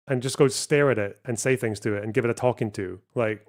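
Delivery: usual speaking voice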